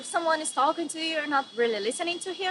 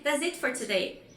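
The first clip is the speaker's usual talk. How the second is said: ordinary delivery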